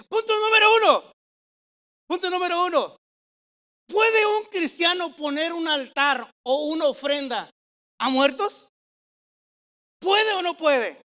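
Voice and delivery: loud, high-pitched pulpit proclamation; preaching at full force. Real, not acted. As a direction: thunder out the sermon point. Preacher opens the first point.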